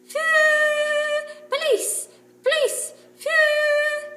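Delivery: very high, loud squawk ending in a whistle; parrot squawk. A narrator's reading. Kiki the parrot mimicking the police skit, squawking. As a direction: screech like a parrot then whistle.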